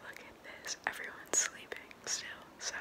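hushed whisper so nobody wakes. Real, not acted. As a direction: whisper softly, family asleep. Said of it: "I have to whisper".

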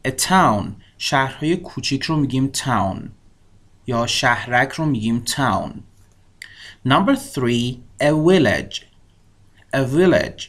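ordinary delivery

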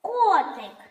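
"Котик" called high with a long О; calling out. Shown as a demonstration. Teacher confirms the stressed О, high calling "КООтик". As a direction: call КООО-тик, falling at the end. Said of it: "Тут наголошений О".

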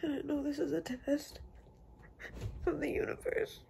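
high, breaking voice through tears; crying hard while talking; inconsolable weeping. Real, not acted. Breaking down mid vlog.

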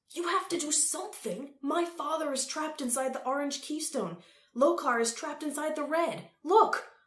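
high, loud desperate appeal; desperate urging. A narrator's reading. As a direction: plead urgently, building to a shout. Boy desperately urges the rulers.

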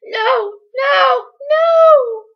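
screamed refusals, very high and loud; rising shrill cries. A narrator's reading. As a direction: scream it out, climbing to a long last wail. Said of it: "her voice rising shrilly".

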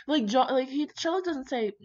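ordinary talk

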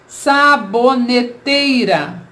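"Saboneteira" said slowly, all joined up; slow, clear, unbroken "Saboneteira"; careful whole word. Shown as a demonstration. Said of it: "Vamos separar em sílabas".

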